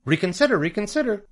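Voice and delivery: very high goose plea; goose crying out. A narrator's reading. The goose makes a last appeal to Wilbur, crying out. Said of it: "cried the goose".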